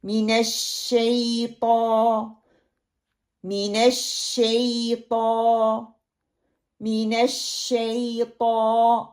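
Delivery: slow chanted من الشیطان; slow recitation. Shown as a demonstration. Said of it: "ایک لفظ کو دوسرے لفظ میں". (آہستہ تلاوت)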